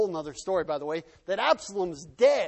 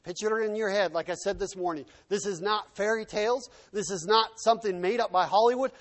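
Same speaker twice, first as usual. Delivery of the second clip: ordinary delivery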